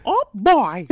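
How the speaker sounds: high, loud cartoon catchphrase; emphatic squeaky cartoon-mouse voice; Mickey Mouse. Shown as a demonstration. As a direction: demonstrate it properly, punchy and high. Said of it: "Get it right".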